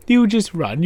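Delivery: ordinary talk, nothing marked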